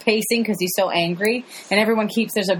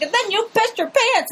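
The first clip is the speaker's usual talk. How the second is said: teasing accusation; 13 semitones higher than usual. Retold or imitated. Voicing George teasing.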